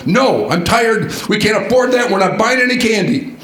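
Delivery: a mock sharp no to a begging child; retold angry snapping. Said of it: "snapping at him in an angry tone".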